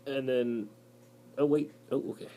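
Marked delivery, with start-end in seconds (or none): none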